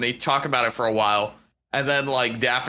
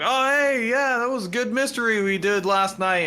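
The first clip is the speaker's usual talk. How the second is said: much higher, chipper Freddy; bright hero greeting, pitched way up; 11 semitones higher than usual. Retold or imitated. Retelling the TikTok, high cheerful Freddy. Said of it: "Freddy's like".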